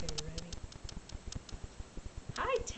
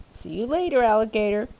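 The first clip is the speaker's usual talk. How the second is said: ordinary delivery, nothing marked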